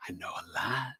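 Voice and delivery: breathy whispered aside; whispering. Real, not acted. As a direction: drop to a whisper. Humble aside mid-sentence.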